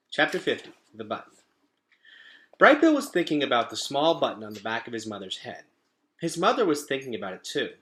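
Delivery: put-on reading a chapter to children; lively read-aloud voice; story narration. A narrator's reading. Teacher reading a chapter aloud to her class.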